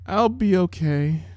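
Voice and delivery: a weak little filly shielding her eyes; small sick-child voice. A narrator's reading.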